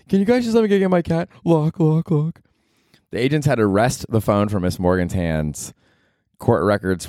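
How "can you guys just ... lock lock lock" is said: high, hammed-up pleading voice; high mock plea, then lock lock lock; mock frantic lady. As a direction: play her panicky and pathetic. Mocking lady locking her phone.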